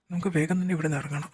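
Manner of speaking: ordinary talk, nothing marked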